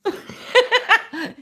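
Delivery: high burst of laughter; loud laugh. Real, not acted. Reacting to absurd clip.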